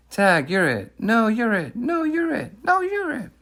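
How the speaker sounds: high, squealing schoolyard back-and-forth; playful kids at tag. Acted. Two young friends playing tag across the street, playfully squealing.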